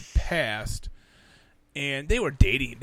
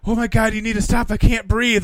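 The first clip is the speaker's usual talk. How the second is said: loud, high mock frantic plea; imitated frantic cry; 7 semitones higher, 8 dB louder than usual. Imitating mom overheard through the door, frantic. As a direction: cry out urgently, hammed-up.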